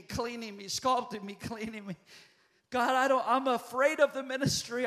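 usual speaking voice

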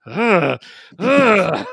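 high, drawn-out groan; weary groan. Retold or imitated. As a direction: groan in fed-up exhaustion. Group groaning at length.

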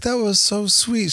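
high, slow gushing of a touched lady; touched, imitated. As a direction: imitate someone melting at a kid's kindness. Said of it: "She's like".

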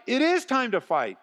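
very high, impassioned preacher's cry; rally them, hitting IS hard; fired-up rallying cry. Real, not acted. Pastor agreeing with eager supporters about fighting, fired up.

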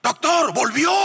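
high, shouted alarm; startled calling out. Acted. Nurse alerts doctor that the dead patient returned, startled shout. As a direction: call across the ward to the doctor.

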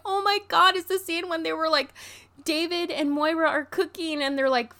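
thrilled to find the scene; excited. Retold or imitated.

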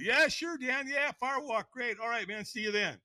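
high-pitched, fake going along with it; subservient agreement. Teller hides his refusal from Dan, falsely agreeable. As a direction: play yourself meekly agreeing to anything. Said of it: "I'm being subservient".

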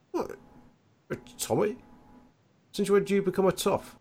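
high, surprised barman voice; a startled older regular calling out; surprised barfly voice; Tony's surprised greeting. Acted. GM voices Tony greeting Tommy.

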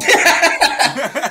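sudden loud high cackle; loud laugh burst. Real, not acted. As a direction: explode into laughter. Joke about the stand-in.

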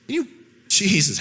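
usual speaking voice

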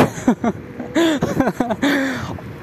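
high, breathy giggle fit; high laugh. Real, not acted. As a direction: laugh at how absurd it got. Laughing at the mess.

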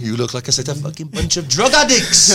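hammed-up Welsh accent, half laughing. Band member imitates the producer in the pub, in a loud mock Welsh accent. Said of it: "I can't do accents, but he was like, Welsh, innit".

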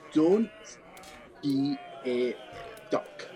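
Don't then a stumble into duck; halting mimicry. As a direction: sound it out unsure, landing on duck. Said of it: "roll performance to try and mimic".

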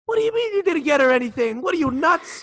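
high, exasperated Jerry voice; whiny sitcom disbelief; Jerry Seinfeld impression. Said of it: "and Jerry says".

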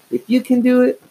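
high, piercing insistence; shrill. A narrator's reading. Younger sister insisting she can do it too, shrill. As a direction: squeal it out high and pushy. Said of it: "she added shrilly".

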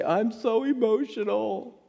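high, slow, sing-song quote of his daughter; lightly voiced daughter, mock dramatic; daughter's dramatic line. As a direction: mimic a teen whining she's so emotional. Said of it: "Stephanie used to say".

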